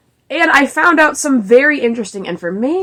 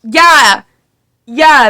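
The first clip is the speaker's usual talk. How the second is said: sarcastic; 3 semitones higher, 5 dB louder than usual. Real, not acted. Mock agreement with the quote.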